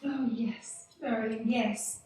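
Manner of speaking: soft, low and slow reassurance; tender murmur. Acted. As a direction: comfort him gently. Wife soothes her husband.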